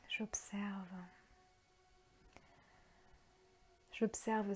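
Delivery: half-whispered calm, drawing out each phrase; guided meditation. Acted. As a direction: guide them gently into their breathing, unhurried. Leads a guided breathing meditation.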